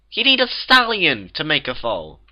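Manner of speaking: high, loud insistent statement; exasperated explanation. A narrator's reading. Twilight corrects the Cakes, exasperated explanation.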